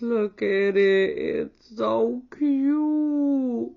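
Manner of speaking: slow, high cooing over something cute. Real, not acted. Showing viewers the tiny charm, slow high coo.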